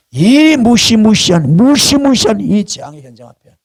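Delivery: high-pitched, drawn-out preaching emphasis; impassioned, high and sweeping; dramatic dread. Real, not acted. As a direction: stretch the words to make the disaster loom. Dramatizing a terrifying disaster scene.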